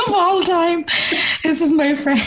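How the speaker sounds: talking through rising laughter; high-pitched, giggly words; real laughing. Real, not acted. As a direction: barely get it out for laughing. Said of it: "I laugh so much".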